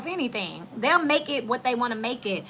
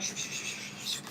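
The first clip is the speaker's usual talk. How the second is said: soft whispered shushes; hushing; 13 dB quieter than usual. Retold or imitated. People hushing gossip as someone walks by, whispered hushing. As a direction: hush each other under your breath.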